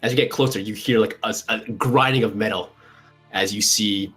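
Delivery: ordinary talk, nothing marked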